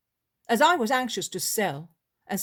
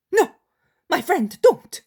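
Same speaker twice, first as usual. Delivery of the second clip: high, loud desperate cry; panicked plea; 9 semitones higher, 9 dB louder than usual. Retold or imitated. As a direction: shriek to stop him walking away. Isaac begs him not to go.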